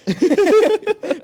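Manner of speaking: loud, high burst of laughter; real high-pitched laugh, breaking out loud. Real, not acted. Cohost cracks up at speaker's aside about God, loud high laugh.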